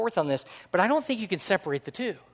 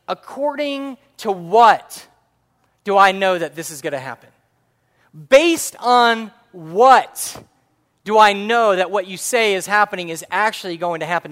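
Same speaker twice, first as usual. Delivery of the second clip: demanding proof; 3 semitones higher, 9 dB louder than usual. Acted. Zechariah demands proof from the angel, loud and insistent. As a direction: stretch the opening words, hammering them out loudly.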